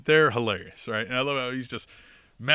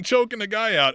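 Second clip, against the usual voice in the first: normal talking voice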